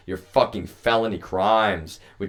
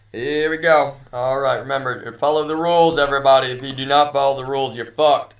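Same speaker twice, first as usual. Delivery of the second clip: higher and louder, announcing to a crowd; calling out loud; 5 semitones higher, 7 dB louder than usual. Real, not acted. Telling everybody to follow the rules, loud and high. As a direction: shout the rules to the whole room.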